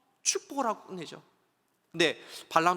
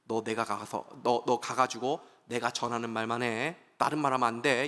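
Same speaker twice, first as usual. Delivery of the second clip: the angel warns the prophet in a low voice; 낮고 엄한 천사의 경고; stern angel; 8 semitones lower than usual. Acted. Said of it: "천사가 뭐라고 얘기합니까".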